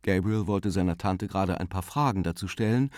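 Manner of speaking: usual speaking voice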